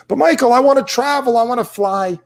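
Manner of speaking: a viewer moaning that they want to travel; whiny viewer. Retold or imitated. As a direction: mimic a complaining fan. Imitating a whiny viewer objection.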